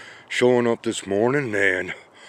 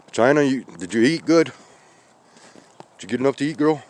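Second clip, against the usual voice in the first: lightly raised, coaxing voice for a cat; sweetly checking on the cat, a bit higher; pet talk; 4 semitones higher, faster than usual. Real, not acted. Talking to the cat China.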